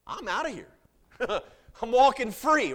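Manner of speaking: high, loud, laughing exclaim; preacher acting the released prisoner, giddy; gleeful freed man. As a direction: burst out with joy at freedom.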